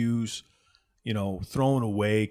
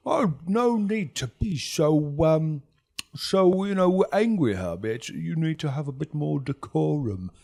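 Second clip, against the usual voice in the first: high, slow, drawn-out placating voice; condescending gang boss; 7 semitones higher, 4 dB louder than usual. Acted. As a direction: chide a rival with affected politeness. Lucian answers Herb smoothly.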